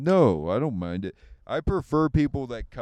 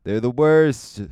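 slow, louder mock comic voice; a rough Mark Norman impression, slow and loud; 7 dB louder, slower than usual. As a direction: ham up a slow, punchy bigot bit. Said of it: "That was terrible".

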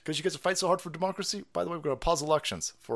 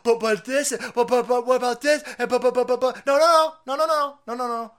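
high, loud sputtering buts; shrill mock protest; 7 semitones higher, 7 dB louder than usual. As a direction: parody a flustered objector. Parodying the dissent's objections.

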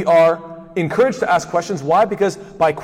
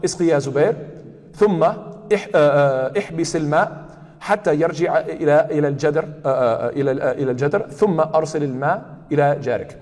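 Arabic hadith recitation. Shown as a demonstration. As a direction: recite the Prophet's words carefully.

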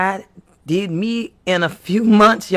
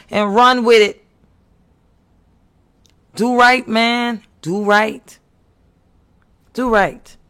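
louder and higher, urgent preacher's call; preaching exhortation; 5 semitones higher, 3 dB louder than usual. Acted. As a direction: drive it home like a pulpit cry.

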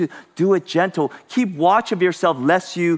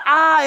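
high loud "ah" of pain; retold pain cry; 12 semitones higher, 6 dB louder than usual. Friend whose shoulder is reset, pained shout. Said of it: "he would shout".

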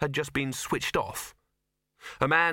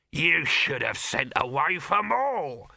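higher-pitched, stretched-out rebuke; snapping; 4 semitones higher, 4 dB louder, slower than usual. A narrator's reading. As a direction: snap at the youth, leaning on each word. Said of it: "snapped Dibbler".

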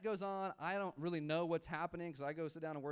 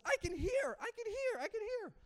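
high, frantic mock scream; retold scream; 5 dB louder than usual. Pastor imitating the girl as her hearing returns, retold scream. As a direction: imitate her ecstatic shrieking. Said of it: "She screams".

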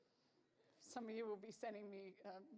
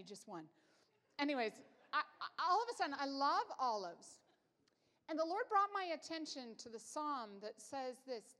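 ordinary talk, nothing marked

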